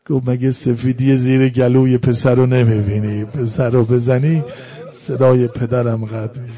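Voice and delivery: very loud mourning sermon cry; full-force lament. Acted. Preacher telling mourners of Hussein and the child, full-force lament. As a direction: cry out the tragedy at full volume.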